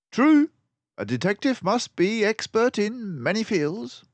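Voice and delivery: high, loud, brisk detective voice; a clever mouse sleuth, pleased with himself; Basil the mouse detective. A narrator's reading. Narrator voicing Basil to Dawson, high and loud.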